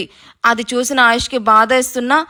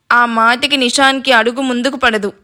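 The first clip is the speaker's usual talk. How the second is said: ordinary delivery, nothing marked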